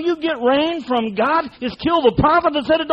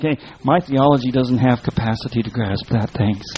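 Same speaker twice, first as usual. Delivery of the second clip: much lower, mocking voice of a doubter; lower, mocking dismissal; mock dismissive churchgoer; 12 semitones lower than usual. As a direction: brush it off like a smug skeptic.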